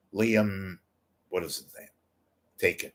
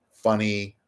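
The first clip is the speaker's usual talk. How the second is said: ordinary delivery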